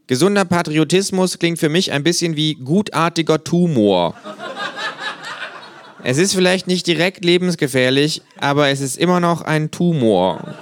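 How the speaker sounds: high, dry, know-it-all kangaroo. A narrator's reading. Kangaroo lecturing a flag-draped fan, high and dry. Känguru voice, held.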